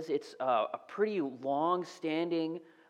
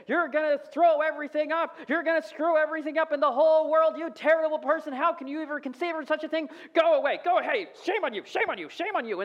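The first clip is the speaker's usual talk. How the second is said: much higher, louder mock outraged shouting; imitated yelling; 11 semitones higher, 6 dB louder than usual. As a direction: ham up an indignant scolding crowd. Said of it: "They'd start yelling".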